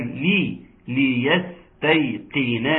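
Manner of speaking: "لي" with a full i, then slow "ليستيقن"; clear kasra on L. Shown as a demonstration. Teacher shows kasra to students, slow "ليستيقن" with a clear "li". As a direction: give the first i of "ليستيقن" its clear sound. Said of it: "تحقيق كسر اللام".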